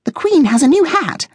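very high-pitched guinea pig voice; squeaky-high, put-on character voice; eager suggestion. A narrator's reading. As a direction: pipe up with a hopeful guess. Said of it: "Coco suggested".

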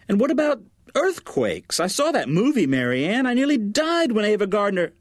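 high, fussing mother piling on worries; panicky mother. A narrator's reading. Mother frets to her daughter, high and anxious.

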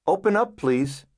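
ordinary delivery, nothing marked

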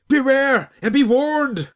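high, slow, shrieking madman; wild unhinged street prophet ranting; lunatic shriek. A narrator's reading. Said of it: "he shrieked in his lunatic voice".

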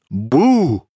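ordinary delivery, nothing marked

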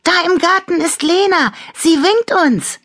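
high, fast, loud squeal; excited call. A narrator's reading. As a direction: blurt out the exciting sighting. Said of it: "rief Annabella".

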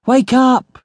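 high, urgent shout. A narrator's reading. Narrator shouts at sleeping captain to wake, shouting. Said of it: "I shouted".

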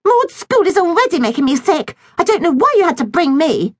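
high, complaining little sister; whiny girl voice. A narrator's reading. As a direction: whine it like a spoilt girl. Millie voiced in the story.

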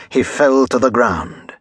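ordinary delivery

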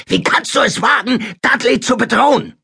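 furious high-pitched bellow; brüllend. A narrator's reading. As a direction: roar over the boy's excuse, voice way up. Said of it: "brüllte Onkel Vernon". (wütendes, hohes Brüllen)

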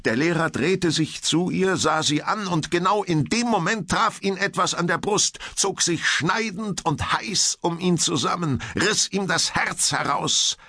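high, intense climactic storytelling; urgent narration. A narrator's reading. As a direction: drive breathlessly towards the fatal blow. Narrator at climax of deadly attack.

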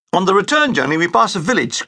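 ordinary delivery, nothing marked

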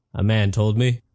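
plain reading voice

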